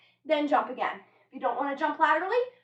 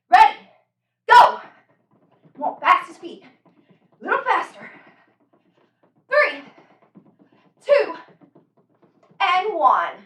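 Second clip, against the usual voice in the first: loud, higher urging calls; barking gym coach; 3 semitones higher, 10 dB louder than usual. Real, not acted. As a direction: drive them to go faster. Coach urging fastest feet.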